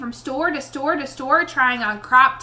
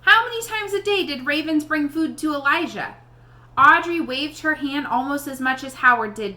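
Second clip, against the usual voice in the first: plain reading voice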